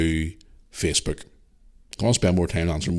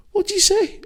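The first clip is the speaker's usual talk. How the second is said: high incredulous squeak; a dad pretending shock at his son's remark; mock disbelief; slower than usual. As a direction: act stunned by the cheek of it. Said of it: "I looked at him and I mean".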